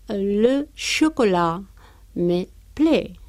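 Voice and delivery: le chocolat me plaît dicho despacio; slow French example. Shown as a demonstration. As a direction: dicta la frase palabra por palabra. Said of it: "entonces diremos". (ejemplo francés lento)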